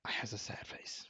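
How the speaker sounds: whispered aside. Real, not acted. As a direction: breathe it out unvoiced. Aside about Pokemon design.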